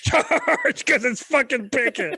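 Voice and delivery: high, loud mock war cry; battle cry. Host voicing Pickett charging the cannons, a mock war cry. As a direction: yell it like a reckless officer charging. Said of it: "looking at those guns going".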